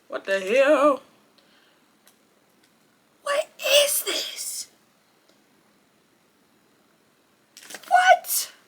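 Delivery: high, slow and baffled; baffled surprise. Real, not acted. Talking to the camera, she is baffled by what she sees, high and incredulous. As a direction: stare at it, totally thrown. Finds something strange on camera.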